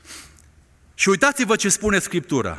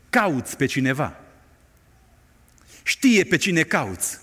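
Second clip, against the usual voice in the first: normal talking voice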